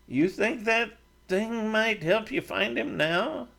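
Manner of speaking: probing a friend about a dream; Matt, curious. A narrator's reading. Matt asks about charm.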